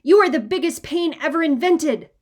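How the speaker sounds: loud furious yell at the little brother; angry big-brother yell. A narrator's reading. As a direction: shout it at full volume. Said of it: "I yelled".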